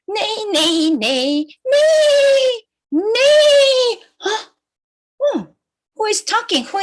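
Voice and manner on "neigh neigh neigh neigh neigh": rising into high, drawn-out neighs; whinny like a horse; horse neighing. Acted. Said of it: "The horse on the farm say".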